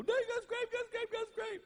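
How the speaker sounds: put-on very high, whiny little-boy voice; whining kid. Son whining to Dad about his scrape, high whiny voice. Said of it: "he was whining about it".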